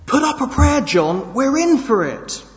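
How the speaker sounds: high, loud panicked yell; terrified shout. Acted. Man yelling to his friend as the bull charges, terrified. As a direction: shout it in terror as the bull closes in.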